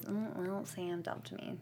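soft, hesitant retold admission; imitating reluctant confession. Jess opening up to Nick, softly imitated confession. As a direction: murmur it as if opening up. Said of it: "Jess ends up opening up to Nick and says".

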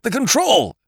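high-pitched frantic wail; panicked, desperate exclamation; hysterical. A narrator's reading. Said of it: "He was babbling hysterically".

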